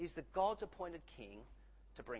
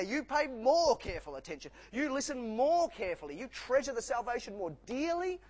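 louder, higher, driving each command home; full-force pulpit exhortation, louder and higher; preaching at full force; 6 semitones higher, 7 dB louder than usual. Acted. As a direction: hammer every "you" like a preacher. Urgent exhortation to congregation.

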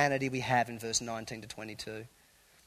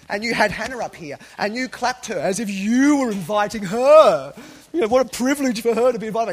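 mock outraged rant; 7 semitones higher, 12 dB louder than usual. Mocking the power-obsessed accuser.

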